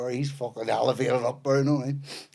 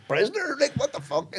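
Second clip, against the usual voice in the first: high-pitched startled disbelief; incredulous; 13 semitones higher than usual. Retold or imitated. Man reliving being declared a prisoner on board, incredulous. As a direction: blurt it out, voice leaping up in disbelief.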